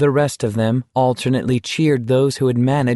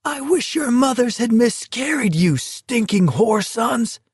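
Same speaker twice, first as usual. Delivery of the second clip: shrieked curse; 12 semitones higher than usual. Acted. Walker cursing the troopers, high shrieking voice. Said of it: "Garrity shrieked at them".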